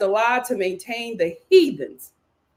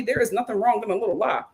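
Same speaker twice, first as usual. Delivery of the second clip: usual speaking voice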